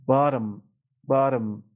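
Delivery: "بارم" for bottom, middle T as a quick tapped d; flap T. Shown as a demonstration. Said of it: "یه دی سری".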